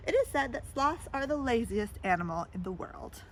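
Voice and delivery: ordinary delivery, nothing marked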